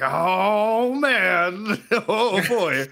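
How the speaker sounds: high, drawn-out "oh man" of delight; mock relish. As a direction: imitate a hobo savouring his high. Acts out hobo relishing the drug.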